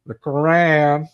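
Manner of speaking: slow, stretched Southern drawl on "The Quran". Shown as a demonstration. Host teases the guest by saying the word in a slow Southern drawl. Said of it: "say, Southern drawl".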